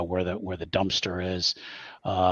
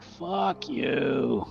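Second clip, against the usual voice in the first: playful fuck you; 5 semitones higher than usual. Acted. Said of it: "in a playful way".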